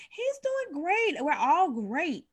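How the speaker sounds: fake cheer. Mother hiding struggle from the doctor, slow forced cheer. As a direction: smile through it slowly. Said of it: "her forced reply".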